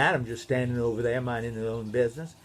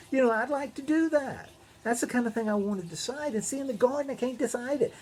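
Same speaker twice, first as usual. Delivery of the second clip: Eve voice; 6 semitones higher than usual. Acted. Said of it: "She was thinking".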